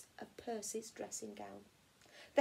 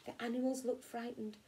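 usual speaking voice

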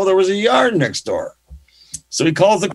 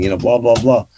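ordinary talk

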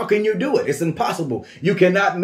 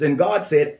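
usual speaking voice

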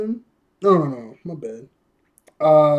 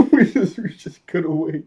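laughing; 3 semitones higher, 8 dB louder than usual. Real, not acted. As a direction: crack up on the first words. Cracks up repeating the anticlimax.

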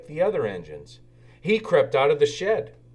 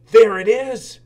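much higher, louder cry of discovery; little engine spotting the light, higher and louder; excited cry; 9 semitones higher, 10 dB louder than usual. Acted. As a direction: shout it out with joy. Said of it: "he cried".

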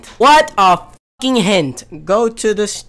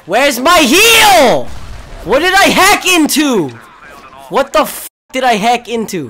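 exasperated demand; 5 semitones higher, 8 dB louder than usual. Real, not acted. Gamer demanding a heal from the team, loud exasperated. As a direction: stretch it out in frustration.

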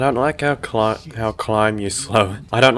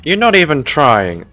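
louder, exasperated reproach; exasperated scolding; 3 semitones higher, 6 dB louder than usual. Real, not acted. Player scolding a struggling game character, exasperated. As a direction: call out a useless teammate, exasperated.

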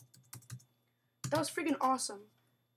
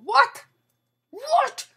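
high, loud shocked cry of what; 11 semitones higher, 12 dB louder than usual. Real, not acted.

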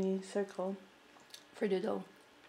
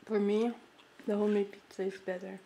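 talking between bites; chewing while talking. Real, not acted. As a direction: mouth partly full. Seen 1: Spaghetti eaten just before; lips pressed, cheeks working while speaking early.

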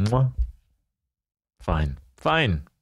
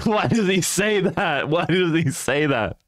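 higher, exasperated and cracking up; amused, incredulous laughing question; laughing disbelief; 5 semitones higher than usual. Real, not acted. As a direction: ask it helplessly through laughter. Cracking up at text-to-speech readout.

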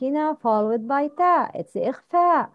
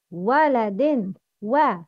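ordinary talk, nothing marked

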